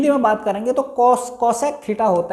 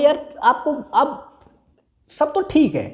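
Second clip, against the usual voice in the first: higher, lightly voiced complaining student; imitating a student; 7 semitones higher than usual. Teacher voicing a student's worry to the class, mock complaint. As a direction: play the puzzled pupil, half-joking. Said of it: "आप समझ रहे होंगे".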